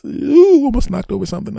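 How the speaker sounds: very high, startled yelp; startled squeal. Acted. Invisible dad nearly knocks something over, startled high squeal. Startled while sneaking past kids.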